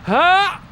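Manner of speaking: short high yelp of shock; retold startled yelp. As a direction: yelp like jumping off a cliff. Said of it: "and then I go".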